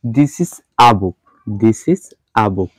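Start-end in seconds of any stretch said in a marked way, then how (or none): 0.0-1.2 slow demo, "a" said as "ah"; "a" as a short "ah", not "ay"; weak article. Shown as a demonstration. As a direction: say "a" as "ah", slowly, before "book". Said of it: "তখন এটার উচ্চারণ হচ্ছে".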